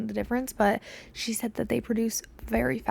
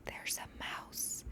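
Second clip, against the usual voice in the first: slow urgent whisper; hushed, slowed warning; 18 dB quieter, slower than usual. Retold or imitated. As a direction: whisper the news slowly. Whispering to a stranger in hallway.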